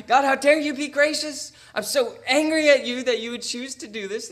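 loud, high indignant accusation; mock-outraged prophet yelling at God; accusing God. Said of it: "accusing God".

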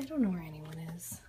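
whispering. Real, not acted. Home alone, hushed aside.

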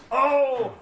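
loud, high yelp of surprise; surprised shout. Real, not acted. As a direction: caught off guard by the ball. Misses the ball completely.

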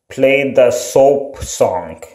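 usual speaking voice

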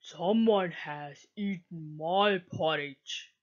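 a deep-sounding grumbling papa bear, softly. A narrator's reading. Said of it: "Papa Bear said".

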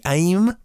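"aim" as one drawn-out syllable, like the English word; one-syllable pronunciation. Shown as a demonstration. Host tries a third version of the car's name, one-syllable pronunciation. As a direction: settle on "aim" as a single slow word. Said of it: "Do I pronounce it".

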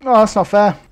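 loud exasperated outburst; frustrated yell. Real, not acted. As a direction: yell it out after the blast. Hit by grenade blast.

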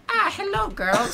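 falsetto villain hello; high creepy greeting. Acted. Villain greeting the girls, high voice. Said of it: "then he's like".

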